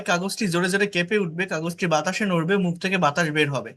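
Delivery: usual speaking voice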